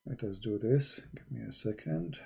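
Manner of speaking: ordinary talk, nothing marked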